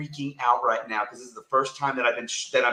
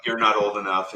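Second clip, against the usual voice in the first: normal talking voice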